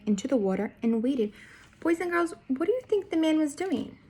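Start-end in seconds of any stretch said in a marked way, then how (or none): 1.8-4.0 bright questioning storyteller voice; reading to children. Acted. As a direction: ask the kids, higher.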